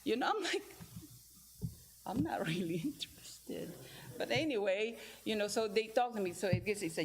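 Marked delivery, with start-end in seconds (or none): 2.0-3.7 whispered through a giggle; laughing whisper. Retold or imitated. Declining the parent job offer, laughing and whispering. Joking about being hired.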